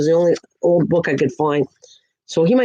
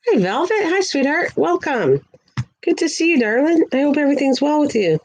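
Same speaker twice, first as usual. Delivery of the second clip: much higher, cooing greeting to a pet; 8 semitones higher than usual. Real, not acted. Greeting Velvet the pet in a high cooing voice. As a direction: coo it sweetly up high like to a little pet.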